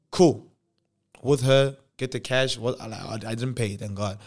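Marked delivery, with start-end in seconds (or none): 0.1-1.8 loud cool. Retold or imitated. Exclaiming after her promise.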